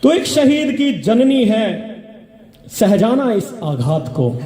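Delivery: measured, rhythmic reciting of verse; stage poetry reading; poem recitation. Acted. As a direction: declaim each line to the audience, letting it fall. Recites poem, repeating lines.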